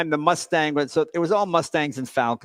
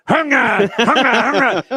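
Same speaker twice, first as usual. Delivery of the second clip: louder, higher mock engine chug; imitated sputtering engine; 7 semitones higher, 8 dB louder, slower than usual. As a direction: sputter it out like a broken muffler. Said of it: "and it goes".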